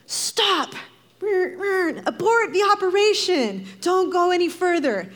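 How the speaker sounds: siren shouting. Pretend. Preacher imitates a warning siren, high alarm shout. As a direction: blare it out like an alarm going off. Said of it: "blinking siren shouting".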